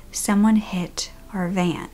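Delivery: flat, slow, near-whispered shock; monotone voice. Retold or imitated. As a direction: say it dead flat and slow, stunned. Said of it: "like, monotone voice that you could ever say something in".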